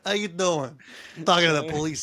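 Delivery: put-on hammy Italian-American wise-guy accent; Joey Tribbiani. Goon talking about the police, New York accent. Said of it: "He's Joey Tribbiani".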